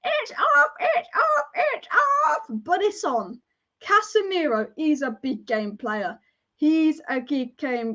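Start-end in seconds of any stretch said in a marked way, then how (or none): 0.0-2.5 much higher whiny mock shout; mocking rival fans. Fan mocks salty rival supporters complaining, whiny. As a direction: whine it like a sore loser. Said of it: "the salty rivals complaining, going".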